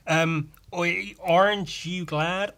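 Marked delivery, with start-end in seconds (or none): 1.2-2.5 slow, higher American imitation; put-on American accent, questioning. Shown as a demonstration. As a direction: ask it like an American. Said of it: "like, an American accent".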